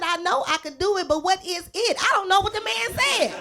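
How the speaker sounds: mock outraged laughing protest; exasperated. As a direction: throw up your hands, laughing. Retelling her confused reply.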